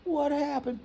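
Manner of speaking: high, slow mock sympathy; consoling mom. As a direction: draw out the motherly pity in a high voice. Mother consoling son over breakup.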